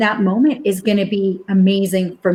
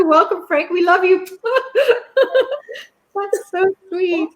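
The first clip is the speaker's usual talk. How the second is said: touched laughter; 8 semitones higher than usual. Real, not acted. Touched by husband's comment.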